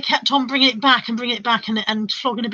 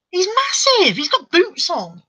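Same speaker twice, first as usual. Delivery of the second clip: high-pitched disbelief, slowing on the boots; squealed amazement at a huge fly; alarmed amazement; 8 semitones higher, slower than usual. Real, not acted. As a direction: exclaim in high comic astonishment.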